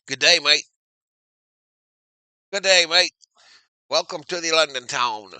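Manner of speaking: loud put-on Aussie greeting; mock Australian accent. Streamer welcoming viewers to London town, mock Australian accent. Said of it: "doing Australian".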